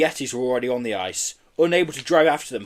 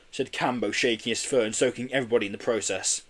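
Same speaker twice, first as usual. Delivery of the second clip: ordinary delivery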